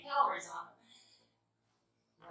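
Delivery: slow, breathless whispered aside; a conspiratorial whisper to the viewer. Acted. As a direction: drop to a whisper for the calories. Aside about toppings on potatoes.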